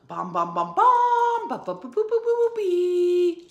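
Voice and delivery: loud sung trumpet notes, leaping high; loud, high-jumping trumpet imitation; trumpet sounds. Shown as a demonstration. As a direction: belt it out like a trumpet. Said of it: "here's what the trumpet sounds like".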